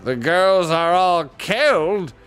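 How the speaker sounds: indignant dwarf, rising to high questions; baffled dwarf Lord Regent. A narrator's reading. Dwarf mishearing the owl's news, baffled. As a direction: play a disbelieving, muddled old dwarf.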